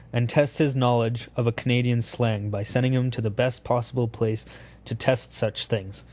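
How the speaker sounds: ordinary delivery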